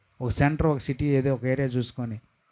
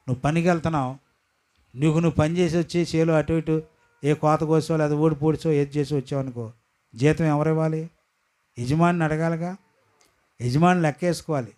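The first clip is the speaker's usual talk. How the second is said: ordinary talk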